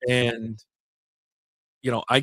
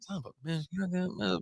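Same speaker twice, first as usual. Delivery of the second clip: mock grumbling under the breath, much softer; retold grumble; 6 semitones higher, 10 dB quieter than usual. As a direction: grumble to yourself, soft and higher. Retold muttered grumbling at a slow-starting car.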